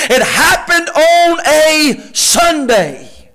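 full-force preaching. Acted. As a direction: drive it home to the church.

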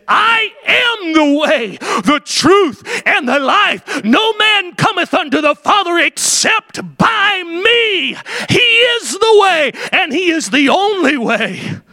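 impassioned pulpit shout; full force preaching. Acted. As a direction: cry out the scripture to the church. Preaching Christ's words.

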